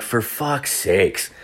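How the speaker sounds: exasperated sigh. Retold or imitated. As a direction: let it drag out, utterly worn down. Exhausted after whole day sick.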